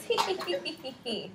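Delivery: read-out giggle, starting high and falling; storybook laughing. A narrator's reading. Teacher reads two kangaroos laughing together, mock giggle. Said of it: "They're laughing".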